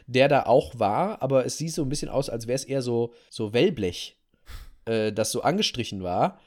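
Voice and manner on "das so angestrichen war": ordinary delivery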